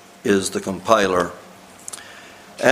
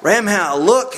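excited exclamation; 9 semitones higher than usual. A narrator's reading. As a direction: call out in sudden amazement. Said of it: "exclaimed Morse".

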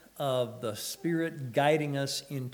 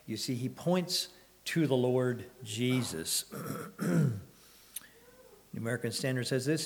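ordinary delivery

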